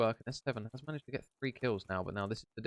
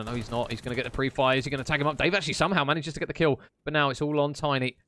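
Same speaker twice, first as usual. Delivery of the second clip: louder excited live commentary; play-by-play call; 2 semitones higher, 5 dB louder than usual. Real, not acted. Caster calling the opening fight for viewers, excited play-by-play. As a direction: call the action as it happens.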